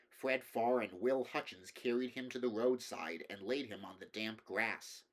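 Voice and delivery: ordinary delivery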